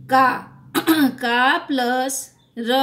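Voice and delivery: drawn-out spelling of का plus र; teacher sounding out letters slowly. Shown as a demonstration. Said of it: "जैसे यहाँ लिखा है".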